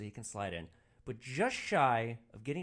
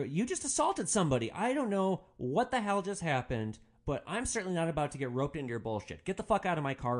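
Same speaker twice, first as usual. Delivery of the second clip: angry; 6 semitones higher, 5 dB louder than usual. Retold or imitated. Driver ordering fighter out.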